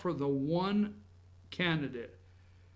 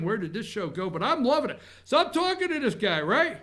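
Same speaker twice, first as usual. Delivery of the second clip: ordinary talk, nothing marked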